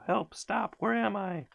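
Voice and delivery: very high, panicked, bewildered cries; dazed cries. A narrator's reading. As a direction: cry out dizzy and lost. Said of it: "cried the crocodile".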